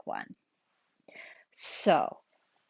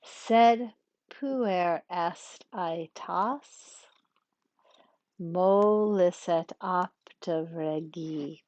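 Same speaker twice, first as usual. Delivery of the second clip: slow, drawn-out Latin verse; metrical scansion; much slower than usual. Shown as a demonstration. Teacher scans another practice line aloud, slow metrical reading. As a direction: stretch the long syllables, rest at the caesura. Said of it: "we're gonna practice a little bit".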